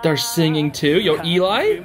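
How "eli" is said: usual speaking voice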